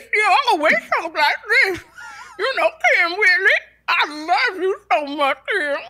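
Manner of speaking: [name] impression. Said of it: "when [name] talks like this".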